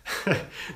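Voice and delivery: quick breathy chuckle; amused laugh. Real, not acted. Streamer reading odd malware comment, amused chuckle. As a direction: let out a small laugh.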